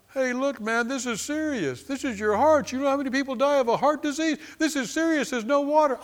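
worried objector. Retold or imitated. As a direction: voice an alarmed sceptic up high.

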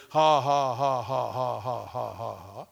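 measured ha-ha-ha stepping down in pitch; deliberate laugh, syllable by syllable, fading; fake laugh. Shown as a demonstration. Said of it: "you don't have to say".

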